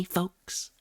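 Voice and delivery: normal talking voice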